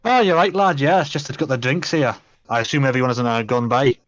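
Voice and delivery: a light, self-confessed bad Scottish accent for the dwarf; terrible Scottish accent. Game master voicing the dwarf Gorsk to the party, in a weak Scottish accent. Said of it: "apologise for my terrible Scottish accent".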